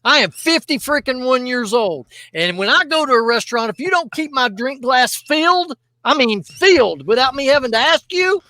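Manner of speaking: worked up, loud complaining rant. Real, not acted. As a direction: really let them have it. Ranting about bad restaurant service.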